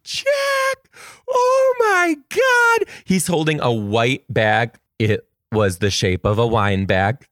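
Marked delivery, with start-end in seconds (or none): 0.0-2.9 high, stretched squeal of delight; greeting a beloved kindergartner at the door, squealing; excited squeal. Retold or imitated. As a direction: squeal his name like a thrilled teacher. Greeting her student at door.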